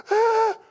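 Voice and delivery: high startled yelp of a movie victim; mock scream. Pastor imitating movie characters, sudden high yelp. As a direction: squeal like someone seeing roots climb their legs. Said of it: "they're standing there going".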